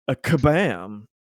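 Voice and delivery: ordinary talk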